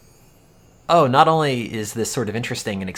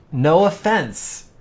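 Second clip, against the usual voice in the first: sarcastic; 8 semitones higher, 5 dB louder, slower than usual. Acted. Mock apology about a boring article.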